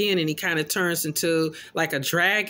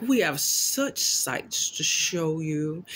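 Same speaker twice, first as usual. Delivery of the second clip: slow, drawn-out, lightly voiced demon; a hint of a hellish priest, slowed right down; slow menacing Pinhead; 3 dB quieter, slower than usual. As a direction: ease each word out like a patient demon. Pinhead voiced rising again.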